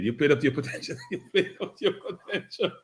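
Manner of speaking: laughing. Real, not acted. Host teasing the losing caller.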